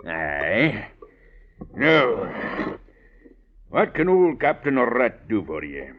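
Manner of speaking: lightly voiced old rogue, sly and easy; a sly old jailbird sizing up a young gentleman; old sea rogue. Old rascal Captain Rat talks to Mister Drew, sly and knowing.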